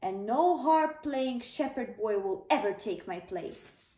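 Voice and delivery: loud, angry booming king. Acted. King booms at Samuel, loud and furious. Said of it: "boomed Saul".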